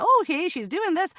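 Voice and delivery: high-pitched Scottish character voice; Scottish lilt, pitched right up; Scottish accent. Shown as a demonstration. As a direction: chat in a put-on Scottish accent. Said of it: "been talking in a Scottish accent".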